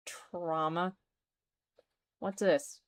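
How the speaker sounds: ordinary talk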